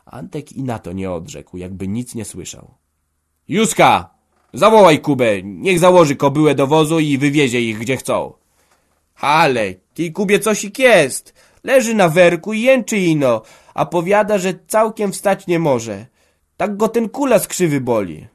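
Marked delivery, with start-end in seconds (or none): 3.5-8.3 loud, higher commanding call; angry order. A narrator's reading. Father orders daughter, still angry. 9.2-18.3 put-on much higher, louder worried girl; a fretful daughter reporting trouble; young girl's voice. A narrator's reading.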